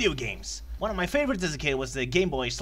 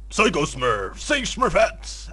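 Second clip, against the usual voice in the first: loud, slow commanding figure; Papa Smurf; 4 semitones higher, 6 dB louder, slower than usual. Acted. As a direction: give a loud, drawn-out heroic order. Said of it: "Papa Smurf goes".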